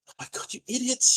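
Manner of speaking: high, exasperated cry of relief; exasperated scold. Acted. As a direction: scold him like a worried big sister. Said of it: "And Isadore's like".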